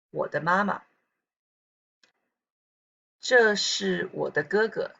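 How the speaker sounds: ordinary talk, nothing marked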